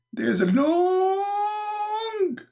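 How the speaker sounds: "long" held high for seconds; hammed-up, endless "long"; drawn-out exaggeration. Exaggerating length of a line.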